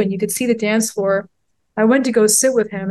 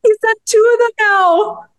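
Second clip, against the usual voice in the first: excited gushing; 11 semitones higher, 4 dB louder than usual. Real, not acted. Gushing about the films.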